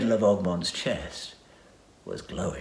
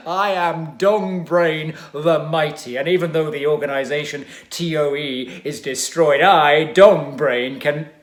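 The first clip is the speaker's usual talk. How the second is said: pompous villain; 7 semitones higher, 10 dB louder than usual. Acted. Villain holding the fox by the neck, booming his own name. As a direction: announce yourself like a mighty tyrant.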